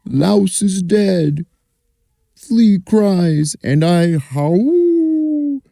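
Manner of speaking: very high, very slow howl-like dog voice. Acted. Dog deciding to howl over Louse, slow and high. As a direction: stretch it out like a small dog about to howl.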